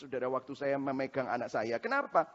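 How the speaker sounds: ordinary delivery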